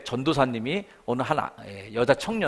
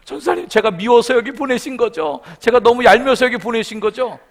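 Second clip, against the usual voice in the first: tearful testimony; 8 semitones higher, 7 dB louder than usual. Retold or imitated. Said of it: "막 간증하는 거예요".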